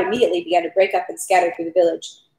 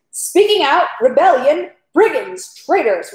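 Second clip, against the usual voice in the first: high-pitched outraged exclamations, falling off; senseless yell; 7 semitones higher, 3 dB louder, slower than usual. A narrator's reading. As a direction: hurl accusations in a raised narrator's yell. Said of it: "Rostov yelled senselessly in the voice, in a voice not his own".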